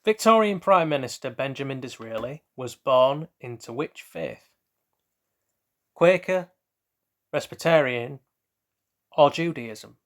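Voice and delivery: bright quiz-host read, options spaced out; quizmaster reading. Acted. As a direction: read it like a quiz show question. Reads out quiz question and options.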